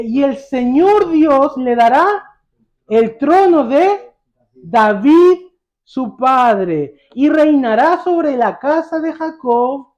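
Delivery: loud, high, slow build of questions; emphatic preaching. Real, not acted. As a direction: call it out to the congregation, phrase by phrase. Preacher quizzes congregation with rising mock guesses. (predicación enfática, en voz alta)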